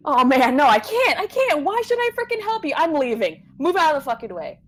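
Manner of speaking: high, loud, agitated protest; panicked refusal. Acted. Frightened boy refusing to help and trying to leave, panicked. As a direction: pitch climbing as the panic takes over.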